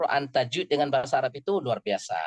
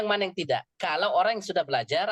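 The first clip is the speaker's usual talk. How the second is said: ordinary talk, nothing marked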